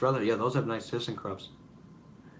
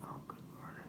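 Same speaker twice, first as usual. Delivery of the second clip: very quiet, whispered exasperation; barely audible aside in dismay; exasperated mutter; 21 dB quieter than usual. Real, not acted. Exasperated by noise behind him.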